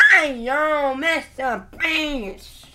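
loud high fan calling out, slow. Pretend. Pretend fan calls loudly and high to MrBeast, slowly. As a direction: hail him like an excited fan. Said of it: "Mister Beast is not really here".

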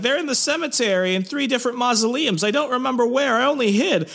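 put-on high-pitched girl confessing; mock frightened girl. Retold girl giving up the secret.

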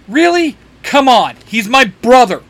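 loud, high-pitched indignant outburst; exasperated snap. A narrator's reading. Dean snaps at the monsters about his brother, exasperated. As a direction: snap back, offended and loud. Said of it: "Dean snaps".

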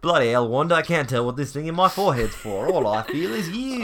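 lightly put-on Australian, teasing; mock Australian accent. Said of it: "Also went Australian for Paul Bettany".

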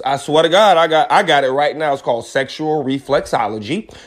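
ordinary talk

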